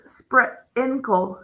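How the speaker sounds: "sprinkle" broken into spr and inkle, short i; slow phonics reading of "sprinkle"; sounded out. Shown as a demonstration. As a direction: slowly split "sprinkle" after the spr, short i as in "in". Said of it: "So we pronounce this".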